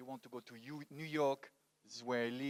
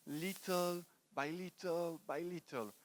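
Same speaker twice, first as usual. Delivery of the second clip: slow and drawn out, higher than usual; gradual, measured; 3 semitones higher, 3 dB quieter, slower than usual. Real, not acted. Speaker explaining how confidence is built, slow and measured. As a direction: stretch LITTLE by little by little, step after step.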